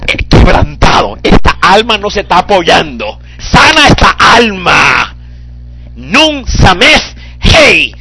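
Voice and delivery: loud, slow fervent preaching; preaching at full force. Acted. Preacher pleading over the congregation, loud fervent preaching.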